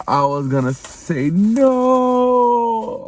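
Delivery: high, slow relieved rise; relieved, surprised. Real, not acted. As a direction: let relief stretch it out. Relief after finding item.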